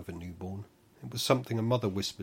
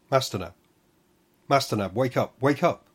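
urgent shout, louder and higher each time; 4 semitones higher, 9 dB louder than usual. Acted. Tamsy shouting to wake Nab, rising panicked shout. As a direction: yell to wake a sleeper, building. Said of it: "Tamsy's shouting tore me".

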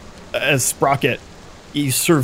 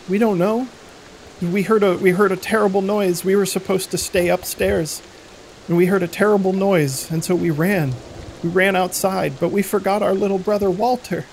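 put-on higher child voice, worried; a girl explaining her parents vanished; frightened little girl; 8 semitones higher than usual. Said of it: "the older girl shrugs".

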